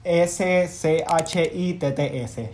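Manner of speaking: letters spelled out one by one, slowly; slow spelling. Shown as a demonstration. Host spells the show's name out for his co-host, slowly letter by letter. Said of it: "Aquí en el podcast deletreando".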